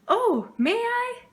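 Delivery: high, slow overjoyed question; can hardly believe her luck; delighted. Acted. Sister thrilled Papa lets her go play, delighted.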